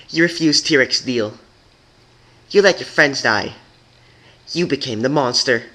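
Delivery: high, loud accusing voice; angry accusation. Acted. Pink unicorn accusing Twilight, angry. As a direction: list her crimes in anger.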